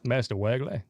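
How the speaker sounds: put-on light New England accent, "Master Wegley" said "Mastah Wegley". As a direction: drop the r in "Master Wegley" like Boston. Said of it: "say it with a New England accent".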